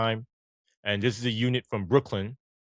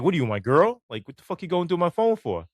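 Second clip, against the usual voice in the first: retold indignant protest; 6 semitones higher than usual. Retold protest at lieutenant's phone demand.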